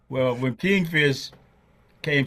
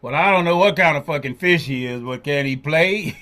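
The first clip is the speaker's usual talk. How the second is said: imitating Buddy; 3 semitones higher, 6 dB louder than usual. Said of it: "He said".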